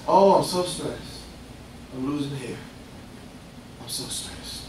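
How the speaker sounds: mock fretting, fading to a whisper; whining about hair loss, voice dropping away; stressed believer. Preacher mocks a stressed churchgoer, whiny and fading.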